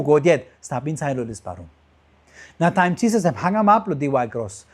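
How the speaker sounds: ordinary talk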